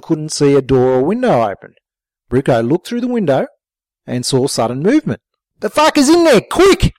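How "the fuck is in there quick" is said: high, urgent shout; yelled. A narrator's reading. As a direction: shout it in alarm. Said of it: "Bricko yelled".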